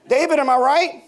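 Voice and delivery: playful laughing tease from the pulpit; laughing teasing. Real, not acted. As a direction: rib David with a laugh.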